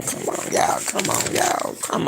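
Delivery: soft breathless come on; exasperated whisper. Real, not acted. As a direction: plead under your breath.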